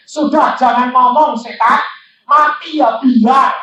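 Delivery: loud, high retold outburst at the devil; acted fed-up shout, slowing at the end; exasperated. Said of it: "saking jengkelnya, saya bilang gitu".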